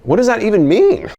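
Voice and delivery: high, incredulous question; exasperated bafflement. Acted. Host questioning his AI co-host's jargon, exasperated and baffled. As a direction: demand an explanation, baffled.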